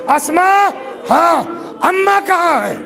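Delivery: high, urgent call, as imitated children. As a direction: call out anxiously for mother, pitched high. Voicing sons calling out for mother. (بچوں کی طرح بلند سُر میں پکار)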